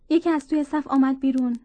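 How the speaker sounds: plain reading voice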